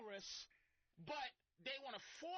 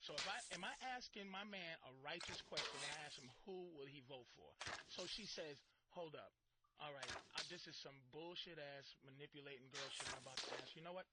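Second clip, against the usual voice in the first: mock wary girlfriend; 10 semitones lower than usual. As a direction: play a woman second-guessing herself, hushed. Woman's inner thoughts voiced.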